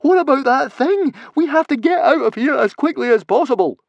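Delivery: high, loud, frantic yelling; panicked protest. A narrator's reading. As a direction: argue at a shout to get away. Panicked argument to flee.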